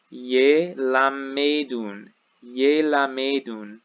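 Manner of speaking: ye lamedun drawn out slowly, syllable by syllable; slow careful reading. Shown as a demonstration. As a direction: spell out ye lamedun slowly for students. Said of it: "And this is".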